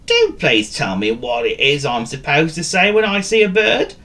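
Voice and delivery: high-pitched begging cartoon dog; pleading dog. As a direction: plead for the answer, voice rising.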